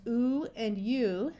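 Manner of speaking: "oo" as a pure vowel, "u" with a y glide; two long U sounds. Shown as a demonstration. Teacher showing the long U's two sounds, "oo" and "u" distinct. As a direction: slowly sound out "oo", then "u" like "you". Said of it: "which has two sounds".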